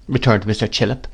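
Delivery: usual speaking voice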